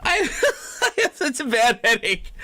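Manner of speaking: laughing complaint. Real, not acted.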